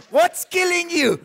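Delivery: usual speaking voice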